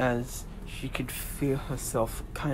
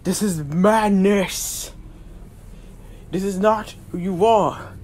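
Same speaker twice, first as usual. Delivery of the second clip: higher, loud, pleading god; a god warning his brother; 3 semitones higher, 4 dB louder than usual. Acted.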